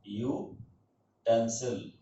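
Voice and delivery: यूटेंसिल said slowly and drawn out, as "yoo-ten-sil"; teacher's careful pronunciation of यूटेंसिल; slow model word. Shown as a demonstration. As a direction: sound out यूटेंसिल slowly for the class. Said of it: "यूटेंसिल का मतलब होता है".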